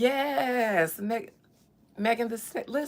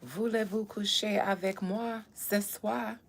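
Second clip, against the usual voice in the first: put-on French accent; 4 dB quieter than usual. Sings French lyric.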